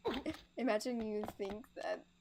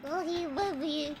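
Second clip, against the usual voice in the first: put-on cooing baby talk; 8 semitones higher, 4 dB louder than usual. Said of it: "gave Raymond a baby voice".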